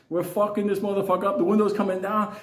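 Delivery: ordinary talk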